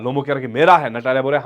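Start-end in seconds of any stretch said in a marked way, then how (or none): 0.5-0.9 louder snapped claim; loud retort. Retold or imitated. Natalia trading claims with Lomov, louder. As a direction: hammer the word home. Back-and-forth ownership quarrel.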